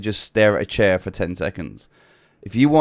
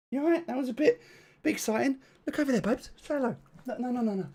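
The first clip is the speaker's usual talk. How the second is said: much higher, softer coaxing puppy voice; 9 semitones higher, 7 dB quieter than usual. Real, not acted. Coaxing the dog to the camera.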